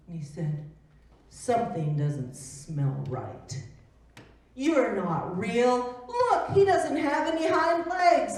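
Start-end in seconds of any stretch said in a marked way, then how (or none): 1.3-3.6 suspicious bunny. Acted. Bunny sniffs the rabbit suspiciously. 4.6-8.4 mocking bunny. Bunny mocks the rabbit.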